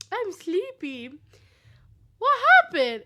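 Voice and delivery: high, slow pretend grogginess; waking princess. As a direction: play just woken and bewildered. Princess just woken up.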